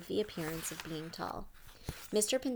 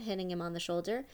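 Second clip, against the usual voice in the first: ordinary delivery, nothing marked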